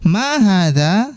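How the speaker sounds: slow, stretched Arabic question for students to repeat; slow model reading. Shown as a demonstration. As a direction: read it out slowly so the class can echo. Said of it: "Saya akan bacakan dan teman-teman". (dibaca pelan sebagai contoh)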